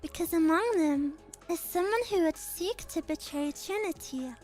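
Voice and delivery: put-on composed noble girl. Same character voice resumes.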